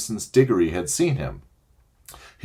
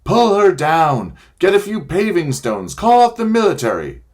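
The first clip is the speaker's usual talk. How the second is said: high, loud angry mob shouting; angry crowd roar; 9 semitones higher, 5 dB louder than usual. Acted. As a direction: bellow it like a furious crowd. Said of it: "an angry roar from the crowd".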